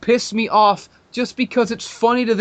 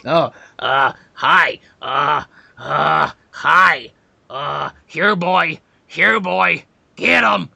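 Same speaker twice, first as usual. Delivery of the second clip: lower, halting, hammed-up nerdy caller stammer; mocking awkward fan; 3 semitones lower, much slower than usual. Mocking the caller who phoned him, lower stammering fan voice. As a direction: stumble through it like a nervous fanboy. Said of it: "he's just fucking".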